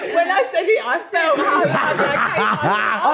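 slow words through laughter; laughing at herself. Real, not acted. Laughing about own whisper.

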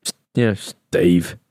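low, slow mock geezer drawl; lightly voiced bloke, dropped low and slow. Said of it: "stereotypical geezer name".

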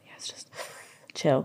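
tiny whispered pep talk; whispered self-calming. Retold or imitated. Singer imagines calming herself beside Harry in a whisper. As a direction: whisper to yourself to stay calm. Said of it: "I think I would be like".

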